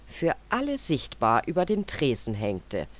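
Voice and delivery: ordinary delivery, nothing marked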